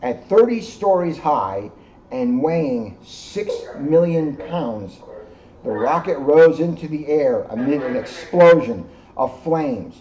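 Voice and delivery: gentle read-aloud storytelling; story narration. A narrator's reading. Reading the moon story to the children, storyteller voice. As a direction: tell it like a bedtime story to kids. Reads picture book to children.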